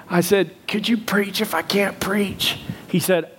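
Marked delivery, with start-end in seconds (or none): 0.7-2.6 slow, strained whisper; lost voice. Acted. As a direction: plead slowly with no voice left. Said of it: "I lost my voice".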